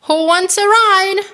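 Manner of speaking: high, eager little-truck voice; Blue inviting everyone. A narrator's reading. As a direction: call out an invitation. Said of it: "said Blue".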